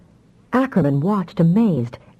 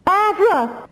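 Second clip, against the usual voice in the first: put-on much higher, wailing little girl; child's wail. A narrator's reading. Frightened child wailing for Afra. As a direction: wail for help like a scared toddler. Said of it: "Damia wailed".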